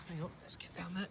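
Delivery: whispered, creeping close; whispering. Real, not acted. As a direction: breathe it so the frog stays. Creeping up on the frog.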